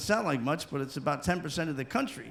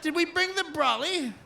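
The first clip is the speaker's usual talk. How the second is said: high, cheery mock English accent; 7 semitones higher than usual. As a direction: shrug off the rain politely in an English accent. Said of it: "English people, they go outside".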